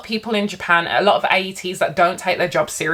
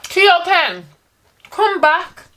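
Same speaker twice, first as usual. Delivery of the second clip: calling out; 8 semitones higher, slower than usual. Real, not acted. Calling someone back to table.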